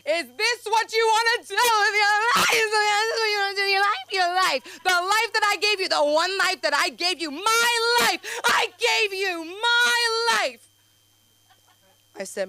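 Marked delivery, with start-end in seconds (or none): 0.1-10.7 high, loud sobbing shout; hammed-up mom crying out about her life; hysterical mom. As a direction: wail it like a distraught mother. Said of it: "She was like".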